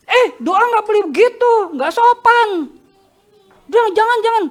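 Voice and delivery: put-on high, sharp scolding of a fussy older woman; high, fussy auntie scolding; scolding church ladies. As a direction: tell the boy off like a prim churchgoing auntie.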